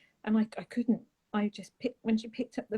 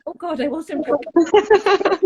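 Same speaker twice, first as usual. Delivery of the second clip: higher and louder, laughing it out; 8 semitones higher, 13 dB louder, slower than usual. Real, not acted. Caught off guard by her son, laughing. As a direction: laugh helplessly through the words.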